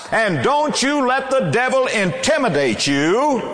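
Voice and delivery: high-pitched fervent preaching, building; preaching at full force. Acted. Preacher urging the congregation to fight for loved ones, fervent and high. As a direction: rouse them to fight for their families. Peak of sermon.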